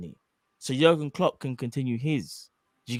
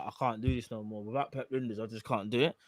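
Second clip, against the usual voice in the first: mock dejected Klopp; 6 semitones lower, 10 dB quieter than usual. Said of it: "Jurgen Klopp's like".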